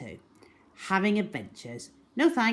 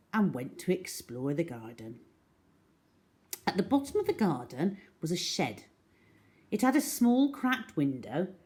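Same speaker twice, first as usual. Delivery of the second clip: ordinary delivery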